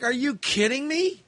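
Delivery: high, incredulous are you kidding; exasperated disbelief. Retold or imitated. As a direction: throw up your hands in mock disbelief. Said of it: "And I'm like".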